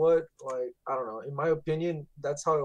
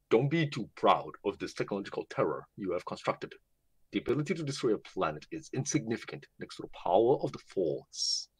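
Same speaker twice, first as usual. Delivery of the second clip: deep, low movie-villain voice; practiced impression of a deep-voiced dark lord; Darth Vader; 6 semitones lower, faster than usual. Shown as a demonstration. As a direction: intone it low and menacing, close on the mic. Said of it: "Vader. Let's see".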